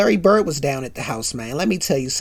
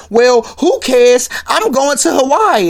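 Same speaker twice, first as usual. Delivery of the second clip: gleeful; 7 semitones higher, 4 dB louder than usual. Retold or imitated. Pam brushes it off.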